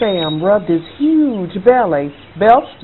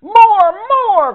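high, loud ghost yell of "More, more!"; mighty bellow; 12 semitones higher, 4 dB louder than usual. A narrator's reading. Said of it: "cried the ghost with a mighty bellow".

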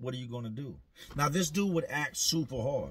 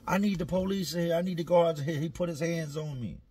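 higher, louder call to the guards; mock panicked plea; 5 semitones higher, 4 dB louder than usual. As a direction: plead loudly for help. D calling the guards.

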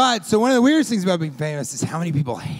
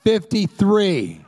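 stretched-out mock shout from the audience; mock yell; 3 semitones higher, 6 dB louder, much slower than usual. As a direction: imitate a wife calling out, slow and louder. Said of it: "she yelled out".

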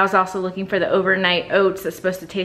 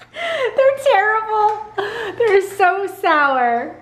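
laughing; 11 semitones higher, much slower than usual. Real, not acted. Sour candy prank reveal.

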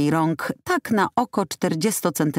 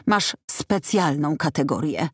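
usual speaking voice

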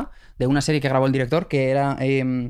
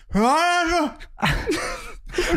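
voiceless squeak; 13 semitones higher than usual. Retold or imitated. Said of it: "abrí la boca y fue como".

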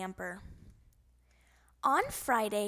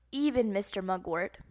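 usual speaking voice

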